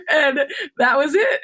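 high, teary, laughing voice; real emotion, laughing and crying at once; laughing through tears. Real, not acted. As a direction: let the tears and laughter both come through. Moved, laughing while tearful.

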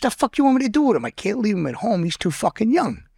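high, fast, exasperated comeback; defensive retort. Retold or imitated. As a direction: snap back fast as the annoyed buddy. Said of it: "He said".

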